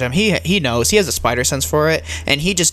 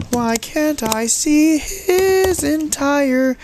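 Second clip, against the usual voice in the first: voice crack; 12 semitones higher, much slower than usual. Real, not acted. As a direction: voice cracking up high. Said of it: "nice voice crack there".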